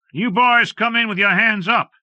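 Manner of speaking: loud, high call from the doorway. A narrator's reading. Ranger calling to the gunmen from the cabin, loud and high. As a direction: shout the order out to the men. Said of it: "he called".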